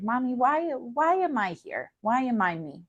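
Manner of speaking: put-on high, wondering child asking her mom; lightly voiced small girl asking why she exists; imitating little girl. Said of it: "I'd be like".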